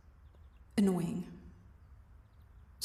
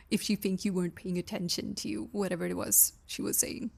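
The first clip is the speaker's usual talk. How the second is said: normal talking voice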